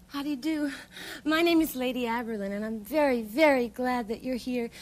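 fast, gushing run-on welcome; too fast. Acted. Lady welcoming the long-awaited teacher, talking too fast. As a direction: rattle it off without stopping for breath. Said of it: "you speak too fast".

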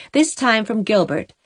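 ordinary delivery, nothing marked

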